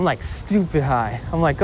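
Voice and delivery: usual speaking voice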